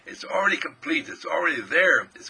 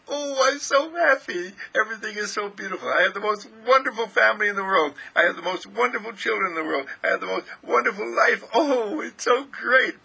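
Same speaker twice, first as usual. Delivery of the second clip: high, elated gushing; out-loud inner joy; 6 semitones higher, 4 dB louder than usual. Acted. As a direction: gush with happiness, voice pitched right up. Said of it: "but out loud in your heart".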